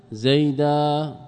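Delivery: زيدا ending in a long held "aa", no n; pause on alif. Shown as a demonstration. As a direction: draw out "zaydaaa" very slowly. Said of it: "وقف على المنصوب منه بالألف". (زيدا بألف ممدودة عند الوقف)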